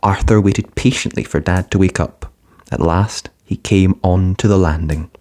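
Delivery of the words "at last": ordinary delivery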